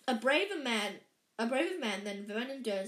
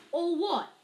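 put-on high, raised-voice boy's question; puzzled question; 7 semitones higher, 8 dB louder than usual. A narrator's reading. Puzzled boy asking the giant, raised pitch. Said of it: "asked Harry".

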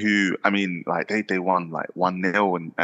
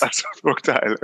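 put-on playful Welsh accent, a bit higher; 5 semitones higher than usual. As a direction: echo it in a light Welsh lilt. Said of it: "Like that".

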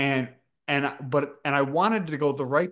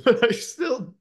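laughing; 10 semitones higher than usual. Real, not acted. Laughing hard mid-sentence.

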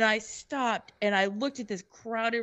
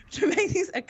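breathy laugh through words; 10 semitones higher than usual. Real, not acted. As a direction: crack up mid-sentence. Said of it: "laughs whisper".

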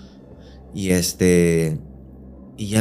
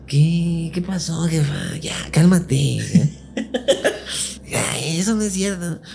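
higher, startled voice; confused son; 9 semitones higher, much slower than usual. Retold or imitated. Son trying to calm his crying mother, pitched up. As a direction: fret at mom, pitched up. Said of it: "yo soy como más".

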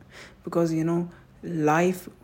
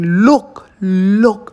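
desperate loud cry, pitched up; screaming; 4 semitones higher, 16 dB louder than usual. Retold or imitated. Pleading with girlfriend to look at him, loud cry. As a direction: yell it at her, begging. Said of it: "I was screaming".

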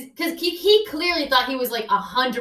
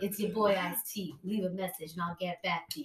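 voicemail [name]; 5 semitones lower, 12 dB quieter than usual. Pretend. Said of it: "his outgoing voicemail".

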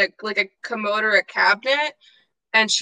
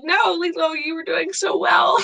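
higher, mock dismayed cry; exasperated social worker; 7 semitones higher than usual. Fan imitating a dismayed adult scolding Lilo. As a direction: playfully wail at Lilo's slip. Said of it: "it's like".

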